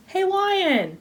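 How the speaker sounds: high, falling teasing call; calling a lion. Pretend. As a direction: call up high to the lion, then let it drop. Teasing the lion with bone again.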